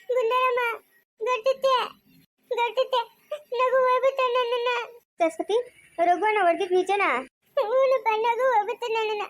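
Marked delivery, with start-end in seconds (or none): 0.1-5.0 high-pitched crying voice; tearful wail. Acted. Hurt woman sobs to her relatives about Raghu, tearful wail. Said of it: "ಅಳ್ಬೇಡ ಸುಮ್ನಿರು". 7.2-9.2 high, sustained crying voice; tearful wail. Acted. Crying woman answers whether Raghu hit her, tearful wail. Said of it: "ಅಳ್ಬೇಡ ಸುಮ್ನಿರು".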